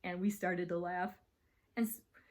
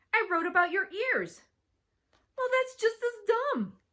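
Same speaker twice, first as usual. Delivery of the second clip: put-on loud, high childish squabbling; heated quarrel; 13 semitones higher, 7 dB louder than usual. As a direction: hurl it back high and loud. Girls quarrelling over insults.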